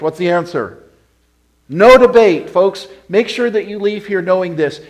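loud, driving pulpit preaching; fiery sermon delivery; preaching at full force. Acted. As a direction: hammer it home to the congregation. Preacher presses the command.

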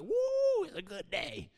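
high whoop dropping soft; gleeful devil. Acted. Devil delighting in people divided over posts, gleeful whoop. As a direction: whoop high, then tail off quietly. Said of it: "Devil is having a heyday. ... he's like".

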